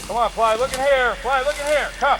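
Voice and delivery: calling from far away. Real, not acted. Calling dog out in the field.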